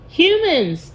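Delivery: high, loud troll snarl. Acted. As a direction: spit the word out in disgust. Troll spits out the word.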